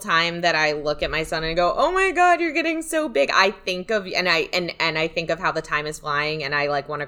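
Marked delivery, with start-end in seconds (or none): 1.8-3.3 put-on high-pitched exclaiming over a child; gushing parent. Mother looking at her growing son, gushing. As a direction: gush in a much higher voice.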